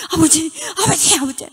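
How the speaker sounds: high, loud wailing call; a lost child crying for her father. Retold or imitated. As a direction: sob out his name, searching. 울매불매.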